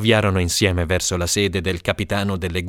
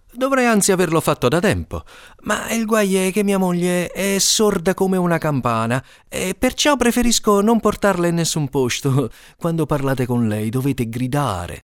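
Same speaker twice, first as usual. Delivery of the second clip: higher, quick joking courtier; sly courtier; 8 semitones higher than usual. Acted. Ferrazzano fooling the queen about his wife, sly high voice. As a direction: spin the lie with a wink.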